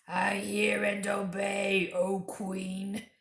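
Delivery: slow, raspy whispered wolf voice; growling wolf. Acted. Wolf Fenris Ulf answering the queen, growling. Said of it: "growled the wolf".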